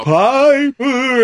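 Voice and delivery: spooky ghost calling, slow and high. Retold or imitated. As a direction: stretch the name out like a haunting ghost. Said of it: "that's my ghost voice anyway".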